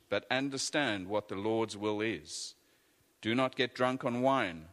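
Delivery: ordinary delivery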